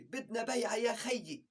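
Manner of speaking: مدير لبناني بلهجة خفيفة; Lebanese boss. Retold or imitated. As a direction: push for a sale like a Lebanese manager. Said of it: "المدير تبعنا لبناني".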